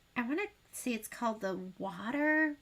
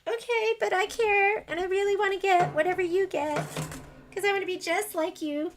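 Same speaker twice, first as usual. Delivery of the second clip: high, gushing fangirl plea; high-pitched, playfully over-earnest; retold eager pleading; 8 semitones higher than usual. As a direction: plead like a devoted copycat. Acts out eager fan's plea.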